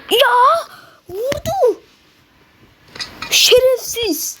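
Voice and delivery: high, outraged cry at being shot; shrill yelp of outrage at the shooter. Real, not acted. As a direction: yelp in furious disbelief. Shot down in the game.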